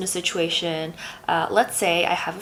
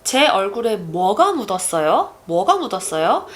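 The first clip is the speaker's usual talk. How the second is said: 뭐가 punched louder, asking what it is; stress on 뭐; 3 dB louder, much faster than usual. Shown as a demonstration. Asking what is on my face, stress on 뭐. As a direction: hit 뭐 in 뭐가 hard. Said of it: "if I put the emphasis on 뭐".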